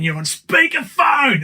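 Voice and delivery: high, slow, loud sing-song yell; high drawn-out shout of a warning; kids shouting. Retold or imitated. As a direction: belt it out like kids in the back seat. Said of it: "'cause they always say".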